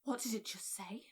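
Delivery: hushed, unvoiced whisper; scared whisper. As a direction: breathe it out in fear. Frightened whisper at the radio.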